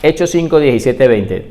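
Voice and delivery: ordinary talk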